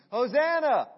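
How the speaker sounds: HOSANNA cried out high and loud; crowd's shout. Retold or imitated. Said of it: "and they shout".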